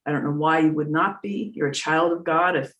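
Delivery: normal talking voice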